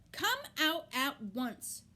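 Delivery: loud, slow, stern call; mother calling her son out; cried out. A narrator's reading. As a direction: order him out firmly. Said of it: "cried Missus Arable".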